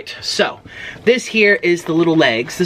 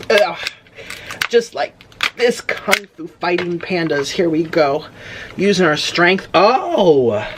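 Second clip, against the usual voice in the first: usual speaking voice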